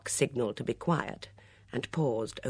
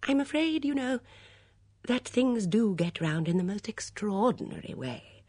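put-on high, gentle old-lady voice; confiding elderly lady, pitched well up; regretful gossip in a light high voice; Miss Marple; 9 semitones higher than usual. A narrator's reading.

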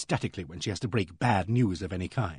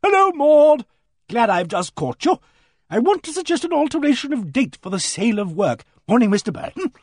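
much higher and louder, hearty hail; a hearty woman calling out loud; hearty booming voice; 11 semitones higher, 7 dB louder than usual. Acted. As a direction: boom it out across the street. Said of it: "hearty voice boomed out".

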